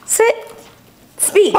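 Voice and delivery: high, bright commands for a dog. Real, not acted. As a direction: chirp the commands.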